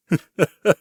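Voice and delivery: a sudden unhinged little laugh; mad giggle. Acted. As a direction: burst out laughing at the fools. Mocking the police's suspicion.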